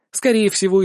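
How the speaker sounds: ordinary delivery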